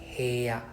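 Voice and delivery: heya said slowly, he then ya; slow clear heya. Shown as a demonstration. Teacher corrects heya's sound for the student. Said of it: "for heya, it sounded a bit weird".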